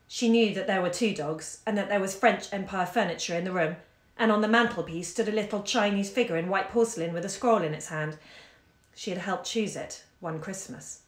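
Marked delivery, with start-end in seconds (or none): none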